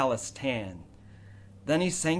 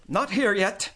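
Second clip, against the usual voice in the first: higher, louder curt aunt; snapped; 6 semitones higher, 3 dB louder than usual. A narrator's reading. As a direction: cut him off sharply. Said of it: "she snapped".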